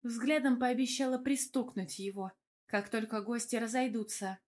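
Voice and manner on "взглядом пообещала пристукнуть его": usual speaking voice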